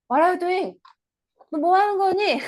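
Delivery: high, loud mother's sharp question; mother scolding. Acted. Mother demanding what her daughter is doing, high and loud. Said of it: "그때 엄마가 이렇게 물어봅니다".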